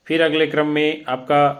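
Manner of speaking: ordinary delivery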